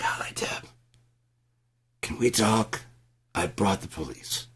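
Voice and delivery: mock sweet whisper to Deb; whispering a rehearsal of the talk with Deb. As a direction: rehearse it hushed and breathy. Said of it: "Act it out a couple more times".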